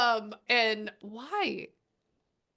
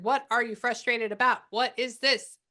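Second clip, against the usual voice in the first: louder, higher puzzled challenge; retold exasperation; 5 semitones higher, 8 dB louder than usual. Medium retelling how she questioned a frustrated spirit, mildly exasperated. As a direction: demand an explanation, playfully exasperated.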